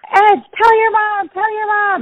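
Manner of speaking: high, urgent imitation of excited kids; kids egging on. Little buddies at the table urging Ed, excitedly. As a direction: pitch way up like kids clamouring.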